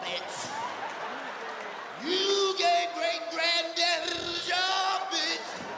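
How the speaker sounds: slow, high sing-song shouting; building rant. Real, not acted.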